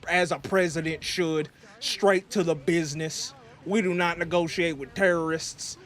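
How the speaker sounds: a lightly put-on American president accent; hammed-up American accent. President persona talking to his viewers, in a put-on American accent. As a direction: play a blustering American president, accent laid on. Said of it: "this little accent that we got".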